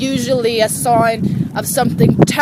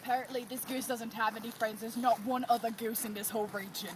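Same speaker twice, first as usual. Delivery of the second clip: soft, quick wildlife narrator; nature documentary; 2 semitones lower, 20 dB quieter, much faster than usual. Pretend. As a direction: narrate it like a hushed nature show. Documentary narration about the goose.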